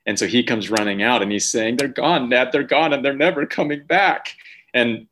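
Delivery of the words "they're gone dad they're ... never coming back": higher-pitched mock distress of a small boy; imitating a tearful child. Son comes running to dad about missing marshmallows, mock tearful cry. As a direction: imitate a kid close to tears, rising toward the end. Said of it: "literally on the verge of tears".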